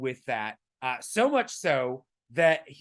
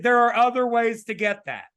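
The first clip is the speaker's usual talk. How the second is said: raised, louder and higher voice; loud joking insistence; 6 semitones higher, 8 dB louder than usual. Shown as a demonstration. Co-host joking about a raspy voice, loud insistence. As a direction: push it out loud and high.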